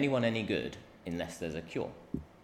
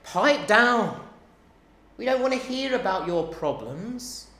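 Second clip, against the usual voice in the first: loud, higher, scolding crowd; crowd rebuke; 8 semitones higher, 6 dB louder than usual. Acted. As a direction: shut the beggar up. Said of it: "many of this massive crowd are saying".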